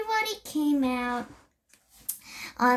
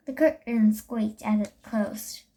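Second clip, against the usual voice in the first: ordinary delivery, nothing marked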